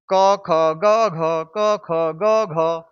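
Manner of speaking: क, ख, ग, घ chanted on steady level notes; even "kuh, khuh, guh, ghuh" for क, ख, ग, घ, run together; clear letter drill. Shown as a demonstration. Teacher reciting the clear pronunciation, measured chant. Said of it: "ये इसका स्पष्ट उच्चारण".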